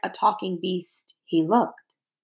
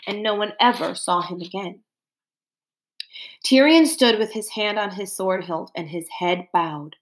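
ordinary delivery

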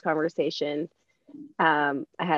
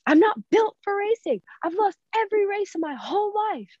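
much higher, small mock-child voice; imitating her son; 12 semitones higher than usual. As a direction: voice the little boy sulking about racing. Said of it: "That's what he said".

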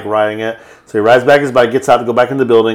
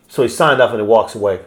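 usual speaking voice

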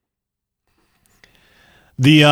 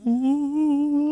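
high, eerie ghost moan; ghostly ooh; 6 dB quieter than usual. Pretend. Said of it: "That's what it sounds like".

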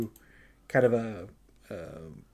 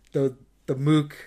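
normal talking voice

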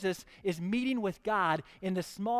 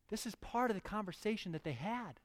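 usual speaking voice

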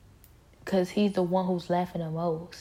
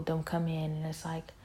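normal talking voice

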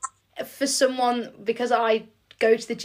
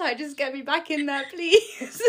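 pitched-up desperate whine; mock pleading; 4 semitones higher than usual. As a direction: beg for the gym, hammed up. Mock pleading to get back in gym.

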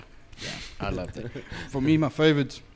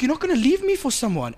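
higher, needy plea in her voice; needy girlfriend quote; 9 semitones higher than usual. Retold or imitated. Girlfriend pleading on the first date, higher and needy. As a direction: mimic her begging not to be left. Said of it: "She's like".

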